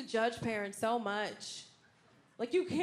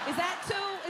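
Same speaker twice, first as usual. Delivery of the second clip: incredulous question; 6 semitones higher, 3 dB louder than usual. Acted. Mocking parents' age in months.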